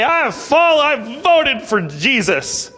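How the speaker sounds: a hint of a smug, self-satisfied believer, high then drawn out slow; lightly mock the person proud of their vote. Preacher mocks a smug believer at heaven's gate, lightly voiced.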